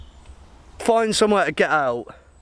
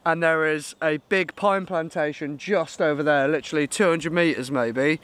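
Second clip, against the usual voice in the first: ordinary delivery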